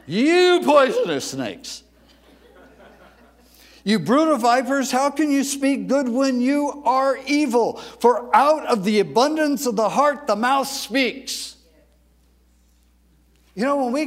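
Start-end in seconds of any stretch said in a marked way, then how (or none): 0.1-11.6 ticked-off rebuke. Acted. Preacher acting Jesus berating the Pharisees, loud and angry. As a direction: thunder it like you're really ticked off. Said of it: "when I'm really ticked off at somebody".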